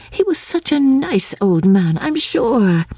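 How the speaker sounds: very high-pitched insistence; earnest protest. A narrator's reading. Lucy protests about the old man.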